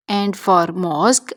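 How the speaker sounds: usual speaking voice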